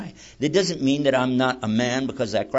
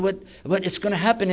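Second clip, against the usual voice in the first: high-pitched imitation of anxious stammering; imitated worry, pitched up; worried question; 7 semitones higher than usual. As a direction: ask it as an anxious newcomer would. Convert worries about what follows.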